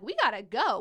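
loud, excited mock kid cry; kids raring to go. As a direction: burst out like kids eager to move. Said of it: "They're like".

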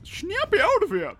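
high, loud celebrity take-off; big high-pitched impression. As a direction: belt it out as a hammed-up diva. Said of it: "never the wrong time for a [name] impression".

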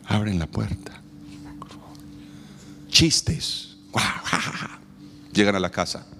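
usual speaking voice

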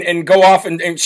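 usual speaking voice

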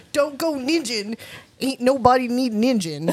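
high, slow Southern drawl, laughing; Southern accent. Retold or imitated. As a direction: drawl it like a country ninja teacher. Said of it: "You do that accent very well".

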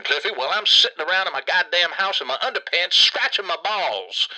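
ordinary talk, nothing marked

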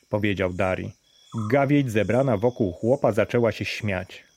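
usual speaking voice

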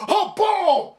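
high, loud burst of delight; oh boom, thrilled and loud; excited shout. Real, not acted. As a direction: yelp it out like a fan. Excited at a photo.

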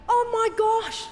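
high-pitched, frantic parent reenactment; retold panic. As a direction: act out panicking over a lost child. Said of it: "getting frantic".

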